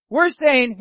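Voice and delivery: usual speaking voice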